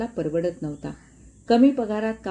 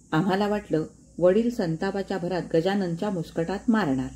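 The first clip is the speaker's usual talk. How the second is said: usual speaking voice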